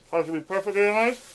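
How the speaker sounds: talking with mouth full. Real, not acted. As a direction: speak around a mouthful of chicken. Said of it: "talking with my mouth full".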